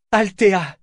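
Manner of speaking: high, loud cry of the name; exclamation. A narrator's reading. Jek finding her friend, a high loud cry. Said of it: "s'exclama-t-elle".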